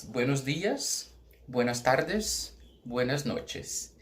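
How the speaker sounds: three greetings said slowly; slow Spanish recap. Shown as a demonstration. Teacher recaps the three Spanish greetings. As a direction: list the greetings slowly and clearly. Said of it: "essas três expressões".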